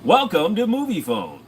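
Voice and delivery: hammed-up Moviefone announcer. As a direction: sell it like an old Moviefone intro. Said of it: "Great voice".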